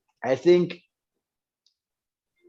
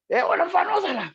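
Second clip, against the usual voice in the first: much higher imitation of the mentor calling the kid over; faster than usual. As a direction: call him over in a high, put-on mentor voice. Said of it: "uBrafana is like".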